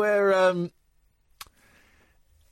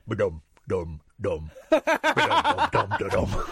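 low mock bass riff; deep vocal bass riff; bass line; 10 semitones lower, slower than usual. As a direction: hum the bassline low. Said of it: "John is on bass going".

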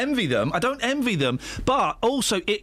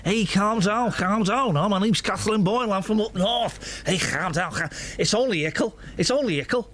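put-on northern accent. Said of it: "a Geordie accent".

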